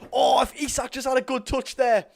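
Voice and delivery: loud, high burst of frustration; near-miss groan. Real, not acted. Streamer reacting to Isak's missed touch, exasperated. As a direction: cry out at the missed chance.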